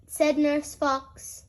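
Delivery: plain reading voice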